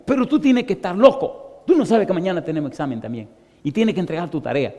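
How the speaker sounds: regaño agudo y rápido; échale en cara la pérdida de tiempo; reproche alarmado. Retold or imitated. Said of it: "me recriminó". (compañero que lo reprende, agudo y apresurado)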